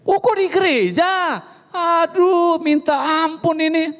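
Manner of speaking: high, slow, drawn-out cry of dismay; high, stretched-out "kok di gereja" in dismay; shocked husband. Retold or imitated.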